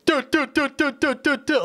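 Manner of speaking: high rapid do do do; imitated gunfire. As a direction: mimic hit markers with do sounds. Imitating gunfire hit markers.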